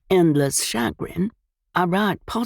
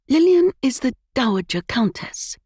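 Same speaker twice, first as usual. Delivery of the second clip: smoothly; 7 semitones higher, 3 dB louder than usual. A narrator's reading. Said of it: "Evelyn said smoothly".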